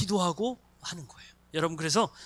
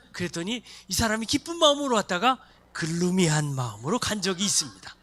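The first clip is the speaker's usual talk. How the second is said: ordinary delivery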